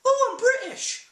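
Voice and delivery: very high, loud, drawn-out mimicry; mock boastful squeal; mocking imitation. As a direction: sneer it back in a silly high voice.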